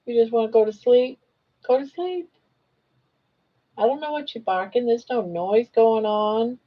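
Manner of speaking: high, coaxing voice for the dog; high cooing dog talk; pet talk. Acted. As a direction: sweet-talk a fussy dog. Talking to the barking dog.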